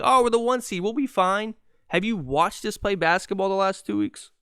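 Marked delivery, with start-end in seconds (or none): 0.0-1.5 higher, breezy mock-casual tone; mocking complacent fans. Host mocking content fans, airy sarcastic voice. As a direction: parody the fans who think all is well.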